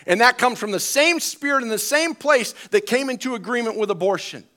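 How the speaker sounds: high, impassioned sermon voice; full-force preaching. Real, not acted. Pastor preaching against devaluing human life, full force. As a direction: hammer each point out to the congregation.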